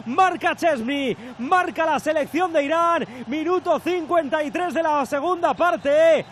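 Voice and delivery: football commentator calling the match; live play-by-play. Acted. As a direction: call it live, rising to the score. Live goal commentary on air.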